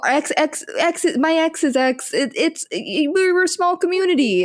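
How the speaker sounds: high, halting put-on voice; hammed-up stammering justification; flustered excuse, imitated. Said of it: "Well, he's just like".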